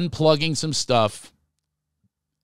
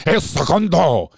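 loud, drawn-out mock Spanish pronunciation; exaggerated Spanish accent; 3 semitones higher, 5 dB louder, slower than usual. Host imitating newscasters at the Spanish part, exaggerated Spanish accent. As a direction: punch it out in hammed-up Spanish. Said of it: "when they get to the Spanish part".

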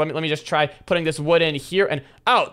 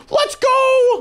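much higher, louder victory shout; triumphant shout; 5 dB louder than usual. Real, not acted. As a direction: shout the win to everyone. Getting the heavenly axe.

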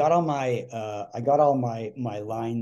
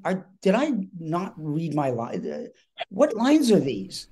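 slow, stretched-out disbelief climbing higher; retold panic; 3 semitones higher than usual. Actor thinks he misread his lines, mock panicked. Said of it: "panicked 'cause I'm thinking".

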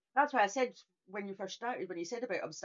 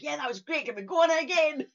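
put-on high, eager kid's plea, building louder; imitated excited child; 6 semitones higher, 5 dB louder than usual. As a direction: mimic a thrilled child, tipping into laughter. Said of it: "She's like".

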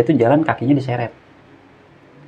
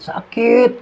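imitated pained scream; 13 semitones higher than usual. Injured hiker shrieking as friend touches his leg, imitated scream. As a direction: cry out in pain, imitating. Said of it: "Dia ngejerit".

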